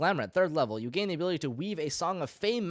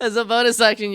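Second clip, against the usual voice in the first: loud restart; 8 semitones higher, 11 dB louder than usual. Real, not acted. Restarts reading after laughter.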